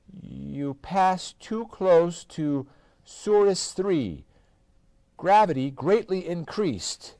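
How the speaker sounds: slow, odd announcing voice; slow card voice. A narrator's reading. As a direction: read it out slowly like a machine. Card voice read.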